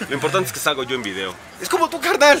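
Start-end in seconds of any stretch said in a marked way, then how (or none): 1.6-2.4 high, loud hype voice; excited. Acted. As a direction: pump it up like a cheer. Hyped joking voice.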